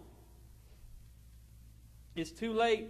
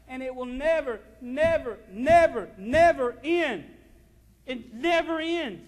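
never, never, never, swelling louder and slower; building preacher shout; 3 semitones higher, 11 dB louder, slower than usual. Acted. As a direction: hammer each never harder, slowing down. Preacher building to full force.

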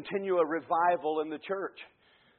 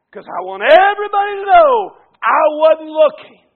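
high, very loud declaration; full-force preaching; 10 semitones higher, 17 dB louder than usual. Real, not acted. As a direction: shout it out so everybody hears.

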